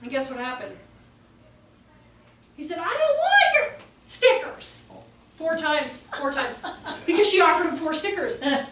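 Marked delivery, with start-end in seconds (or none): 2.8-4.8 high, loud, drawn-out refusal; imitated defiant child. Boy turning down teacher's stickers, imitated loud defiance. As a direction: refuse it like a furious little kid.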